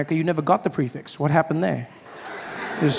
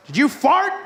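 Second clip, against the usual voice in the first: high mock accusation; 6 semitones higher than usual. America accuses Iran.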